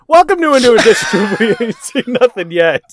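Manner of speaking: upbeat host intro read; podcast intro. Acted. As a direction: announce the show like a host.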